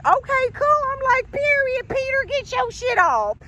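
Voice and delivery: high, squealing cheerleader hype; high playful squeal of approval; hype cheer. Retold or imitated. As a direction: cheer him on like a hype girl. Hyping ex's riches, mock cheer.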